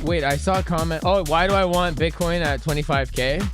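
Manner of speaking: ordinary talk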